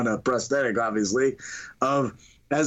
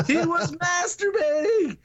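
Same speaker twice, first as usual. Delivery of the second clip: high, drawn-out mock holler; calling out; 14 semitones higher, slower than usual. Mary's brother tells the gathered neighborhood, high drawn-out call. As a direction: announce it to the whole street, stretched out.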